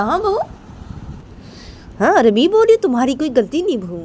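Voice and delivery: high-pitched, swooping accusatory questions; angry scolding. Acted. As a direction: grill the daughter-in-law indignantly. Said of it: "ऐसे गुस्से में बोलती है रायमा को". (गुस्से में ऊँची आवाज़ से डाँट)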